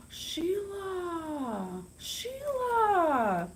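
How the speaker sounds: thrilled fan spotting her favorite author; excited squeal. Real, not acted. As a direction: cry out her name in delight.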